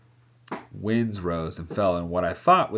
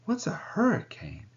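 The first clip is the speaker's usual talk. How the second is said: put-on curious girl; 13 semitones higher than usual. Young sailor voiced, curious.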